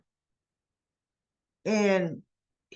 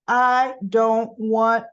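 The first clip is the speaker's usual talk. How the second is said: slow, louder, each word weighted; acted firm refusal; 5 dB louder, much slower than usual. Summer refusing to hear the plan, acted firm refusal. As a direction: lay down the law slowly and firmly.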